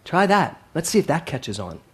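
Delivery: usual speaking voice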